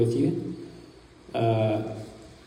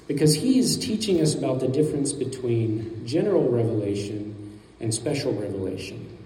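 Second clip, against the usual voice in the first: ordinary talk, nothing marked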